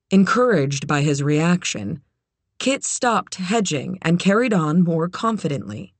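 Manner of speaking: plain reading voice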